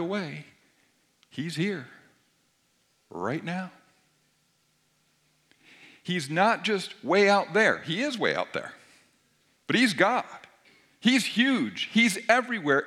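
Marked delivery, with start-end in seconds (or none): 6.0-12.8 louder and higher in awe; proclaiming loudly. Acted. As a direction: proclaim God's vastness with force. Preacher exalting God's greatness.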